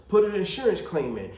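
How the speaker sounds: normal talking voice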